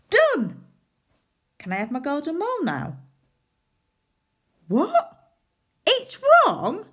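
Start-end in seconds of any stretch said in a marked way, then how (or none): 0.1-0.6 high, loud, triumphant "Done"; proud announcement. Acted. Proudly finishing the sentence. 4.7-6.8 high, loud hammed-up disbelief; mock shock.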